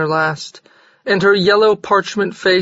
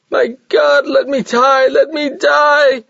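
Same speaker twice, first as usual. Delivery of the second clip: loud, high, slow wail; anguished scream; 8 semitones higher, 3 dB louder, slower than usual. A narrator's reading. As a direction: scream out in agony. Ona screams in labour.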